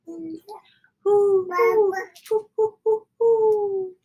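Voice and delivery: high, singing owl call from far off; answering owl hoot. A narrator's reading. The owl answering father from the trees, high hoots. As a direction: hoot back like a distant owl. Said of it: "an echo came threading its way".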